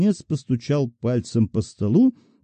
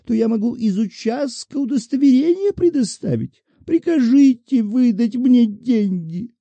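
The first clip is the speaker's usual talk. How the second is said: put-on much higher wheedling old woman's voice; pleading old woman; 10 semitones higher than usual. A narrator's reading. Petitioner begging the director for money, wheedling and much higher.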